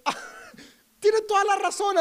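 high, loud burst of realization; father suddenly admits his daughter is right, surprised. Retold or imitated. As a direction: blurt out the admission, caught off guard.

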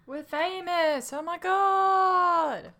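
high, slow, thrilled squeal; excited squeal. Real, not acted. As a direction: stretch it out in delight. Player squeals at the scene.

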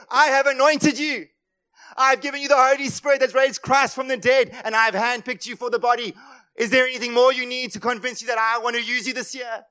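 loud proclaiming voice of God; God's voice, preached. Acted. Preacher voicing God to the congregation, loud and proclaiming. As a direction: declare it as God, full force.

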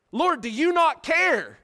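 high, loud, slow exasperated plea; Martha's complaint. Retold or imitated. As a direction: cry it out like the frustrated sister. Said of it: "she questioned whether or not he cared".

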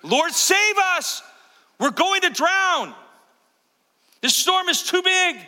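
high-pitched, drawn-out scream for help; desperate shout. Acted. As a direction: yell for your life over a storm. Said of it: "I promise you it wasn't like that".

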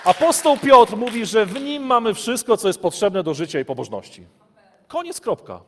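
loud, high, fervent sermon voice; full-force preaching. Acted. Preacher rousing the congregation, loud and fervent. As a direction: preach it out to the hall.

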